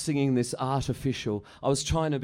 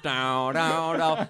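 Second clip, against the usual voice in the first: sung riff; 3 semitones higher, 3 dB louder, much slower than usual. Retold or imitated. Imitating the band riff.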